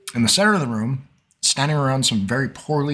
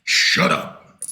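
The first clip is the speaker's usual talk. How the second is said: rough, voiceless bugbear growl; bugbear rebuke; 3 dB louder than usual. Acted. Bugbear pushing the goblin back, rough voiceless rebuke. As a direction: growl it to silence a pest.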